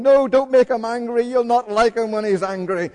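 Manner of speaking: put-on high, loud panicked kid's plea; frightened child pleading. Little boy hiding behind the sofa, begging in high loud fear. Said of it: "hiding behind the sofa going".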